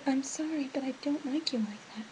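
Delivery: soft near-whisper, imitating her friend; barely voiced, the way she turned him down; imitating a quiet voice. Friend gently rejecting a boy, in a near whisper. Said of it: "Her voice was this quiet".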